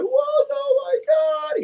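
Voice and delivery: very high, slow shocked shout. Retold or imitated. Man seeing his own wound, shouting high in horror. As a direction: cry out in horror at the sight, pitch soaring.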